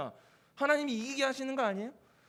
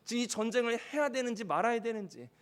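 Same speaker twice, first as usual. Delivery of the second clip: usual speaking voice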